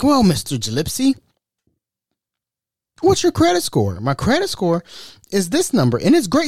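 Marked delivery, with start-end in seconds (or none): 0.0-4.0 high, chirpy salesman; high telemarketer voice. Retold or imitated. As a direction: play the eager caller, pitched up.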